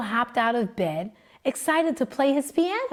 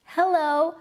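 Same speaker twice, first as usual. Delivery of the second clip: Joe's greeting; 6 semitones higher than usual. A narrator's reading. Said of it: "said Joe".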